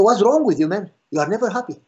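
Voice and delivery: mock frustrated outburst; exasperated. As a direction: throw up your hands at him. Pastor exasperated by the complaint.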